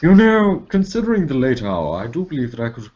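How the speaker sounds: hammed-up British accent; posh British accent. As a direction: play the stuffy British gentleman. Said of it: "how Americans think British people speak".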